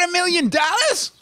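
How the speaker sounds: high, squeaky comic yell whose voice cracks at the end; Chris Rock impression. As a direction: shout it like Chris Rock, voice breaking on the last word. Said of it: "that's my Chris Rock impression".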